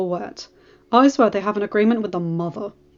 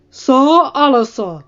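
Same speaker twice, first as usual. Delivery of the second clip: slow, much louder, drawn-out call across the yard; booming call; 6 semitones higher, 12 dB louder, much slower than usual. A narrator's reading. The Lord Commander calling out to rebuke his master-at-arms, booming. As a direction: boom it down the staircase. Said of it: "called a booming voice".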